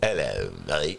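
low, lightly Cockney butler greeting; a lightly voiced Cockney butler says hello; Cockney accent. Host voicing his butler, a hint of Cockney. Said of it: "a Cockney accent".

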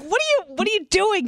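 high, quick baffled questioning; hurried, high-pitched retold disbelief; mock bewilderment. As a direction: imitate a friend's alarmed confusion. Acting out Jose's alarm at her running.

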